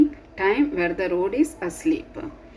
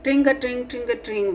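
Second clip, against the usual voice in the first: bell ringing; 5 semitones higher, faster than usual. Acted. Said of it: "says his bicycle".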